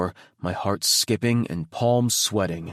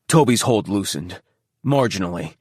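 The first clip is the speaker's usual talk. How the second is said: plain reading voice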